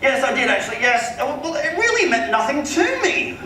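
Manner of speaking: very high, drawn-out affected rich man's voice; Philip Harding, forgetful client. Retold or imitated. Homeowner has forgotten the agent, high and affectedly dismissive. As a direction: mimic a wealthy man dismissing the caller. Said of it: "he's totally forgotten who I am".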